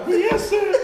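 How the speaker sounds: high-pitched mock "sir" response; obedient lieutenant. Lieutenant answers his general, comically obedient. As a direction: answer like an eager underling, voice way up.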